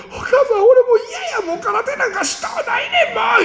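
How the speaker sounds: put-on high, teary child's whimper; a mock-sobbing boy begging to quit; crybaby whine. A beaten boy whines to mother about karate. Kid quitting karate, crying to mom.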